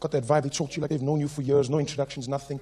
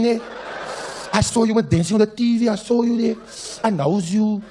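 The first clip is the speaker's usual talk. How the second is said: odd Cape woman; 5 semitones higher than usual. Retold or imitated. Woman claims she saw him dancing on TV, insistent. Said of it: "she was like".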